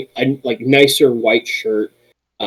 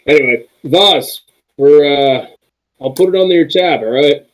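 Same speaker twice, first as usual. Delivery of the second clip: higher quick barkeep; 5 semitones higher, 3 dB louder than usual. Acted. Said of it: "at you four and goes".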